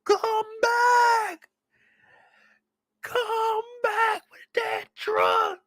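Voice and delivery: high, slow desperate cry after the truck; hammed-up high stretched scream of stop that truck; wailing call for the truck. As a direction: wail it out as if the truck is leaving you behind. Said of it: "if I'm Perry, I would".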